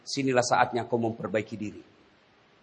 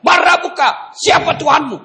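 angel roars the question from on high; thundering angel of the grave; booming angel; 14 semitones higher, 9 dB louder than usual. Acted. Angel interrogating the dead.

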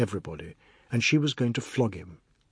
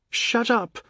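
much higher shrieked shut up; screaming; 11 semitones higher than usual. Acted. Insulted boy screams at his teasing uncle, shrill shout. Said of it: "Anand screamed".

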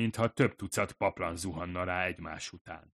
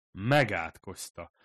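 ordinary talk, nothing marked

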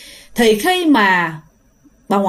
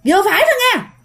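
angry shout; 10 semitones higher than usual. Retold or imitated. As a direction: scold the monk at the top of your voice. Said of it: "bà ngoại la lên nha".

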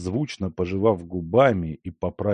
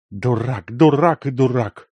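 furious; 4 semitones higher, 5 dB louder than usual. A narrator's reading. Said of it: "с бешенством думал Ляховский".

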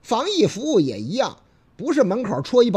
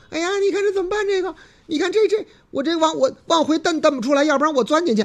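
慌张姑娘; 7 semitones higher, faster than usual. Acted. 姑娘慌张说话.

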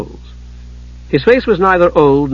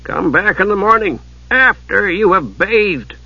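frozen tones; 6 semitones higher than usual. A narrator's reading. Monk sending smelly visitors away, in frozen tones. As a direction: draw it out with frosty disdain. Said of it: "said Bertram in frozen tones".

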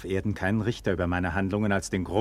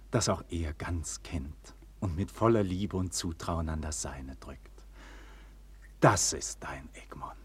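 low, soft, breathy close; tender whisper; 4 semitones lower, 7 dB quieter than usual. Acted. Egmont reveals himself to Klärchen in a loving whisper. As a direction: let it fall softly to her.

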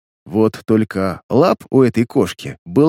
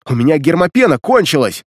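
urgent; 4 semitones higher, 3 dB louder than usual. A narrator's reading. Urgent explanation in danger.

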